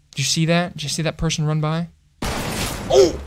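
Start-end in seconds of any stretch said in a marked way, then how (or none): 2.2-3.2 sudden high breathy yelp of fright; a real jump-scare gasp, pitched way up; startled yelp. Real, not acted. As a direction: caught off guard, a sharp high gasp. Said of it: "that scared me so bad".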